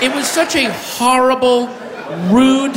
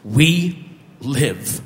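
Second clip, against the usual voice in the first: dramatic movie speech; 11 semitones lower, 4 dB quieter, slower than usual. Retold or imitated. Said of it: "And he says".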